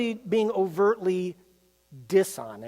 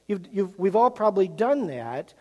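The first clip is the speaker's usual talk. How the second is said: usual speaking voice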